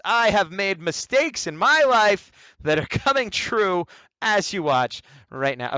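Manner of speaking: high, loud, slow hammed-up lament; mock despair. Artist running out of time, hammed-up despair. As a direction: wail it like a tragedy. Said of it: "dramatic lament about time".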